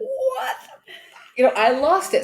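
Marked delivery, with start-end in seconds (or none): 0.0-0.6 sudden high yelp of disbelief, half laughing; shocked shriek. Retold or imitated. Mother reacting to her daughter's news with a high shocked shriek. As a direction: shriek it like the news just hit you. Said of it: "So I was like".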